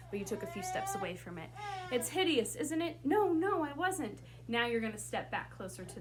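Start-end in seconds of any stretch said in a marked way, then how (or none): 1.9-4.2 higher, alarmed line reading; frightened script reading. Shown as a demonstration. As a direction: act out a flustered denial, pitched up. Acting out frightened script exchange.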